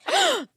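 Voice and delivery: very high, theatrical gasp; mock gasp. Shown as a demonstration. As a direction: gasp like you're shocked. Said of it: "'cause I gasped".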